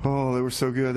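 normal talking voice

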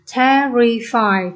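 "terrify" very slowly as te-rri-fy; syllable by syllable. Shown as a demonstration. Teacher having students feel the throat vibrate, syllable by syllable. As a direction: break "terrify" into three drawn-out syllables. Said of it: "Khi đọc ba âm tiết này".

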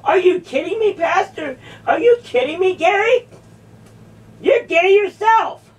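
high-pitched, incredulous comeback; indignant retort. Real, not acted. Told they are a man.

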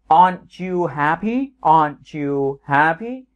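"happy" lifted at the end, slow; rising question tune. Shown as a demonstration. Teacher shows negative question to students, rising on "happy". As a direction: end "Aren't you happy" going up on "happy". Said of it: "mình đá lên ở cuối câu".

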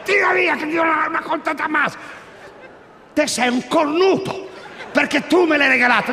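high raging yell; angry shout. Retold or imitated. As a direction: bark it out at the spouse. Couple quarrel escalates. (urlo infuriato)

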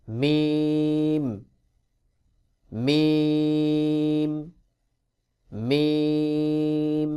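মিম with lips lightly pressed, held very long; long, humming-ended recited মিম. Shown as a demonstration. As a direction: draw out মিম on one level tone. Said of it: "ঠোঁট একটু চাপ দিয়ে উচ্চারণ".

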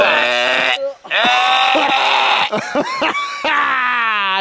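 high drawn-out cackle; long shrieking laugh. Pretend. Laughing at companion in a long high shriek.

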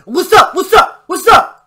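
repeated loud what's up; confrontational shout. Retold or imitated. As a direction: bark it at them like a crew rolling up. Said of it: "And we said".

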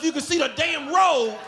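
ordinary delivery

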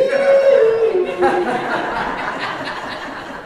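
high loud laughter; real laughter; big laugh. Real, not acted. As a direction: burst out laughing. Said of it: "laughs at story".

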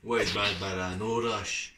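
talking around mouthpiece; words between puffs; vape at lips. Real, not acted. Seen 0: Vape device held at mouth while talking; not there at -1.0.

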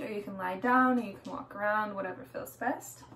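ordinary talk

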